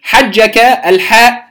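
normal talking voice

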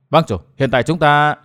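plain reading voice

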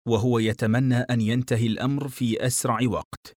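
ordinary delivery

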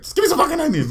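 high, loud exasperated yell; comic shout on the edge of laughter; mock flustered outburst. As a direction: imitate a customer cracking under pressure. Acts out flustered customer snapping at server.

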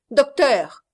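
loud, high call to the doctor; father suddenly crying out to the doctor. A narrator's reading. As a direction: shout for the doctor all at once. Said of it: "s'écria tout à coup".

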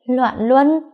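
sharp high disbelieving question; stunned, rising retort; incredulous echo. A narrator's reading. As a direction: throw the word back in shock. Daughter echoes mother's accusation.

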